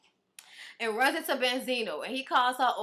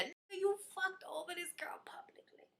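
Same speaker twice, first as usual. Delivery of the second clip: soft unvoiced whisper; 6 semitones higher, 12 dB quieter than usual. Real, not acted. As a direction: hiss it under your breath. Whispered aside insult.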